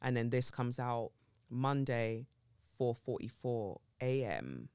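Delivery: normal talking voice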